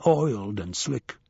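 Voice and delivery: ordinary delivery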